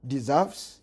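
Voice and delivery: ordinary talk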